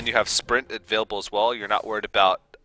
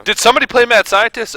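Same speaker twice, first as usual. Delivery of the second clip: excited; 14 semitones higher, 10 dB louder than usual. Pretend. Mock announcer exclaiming card name.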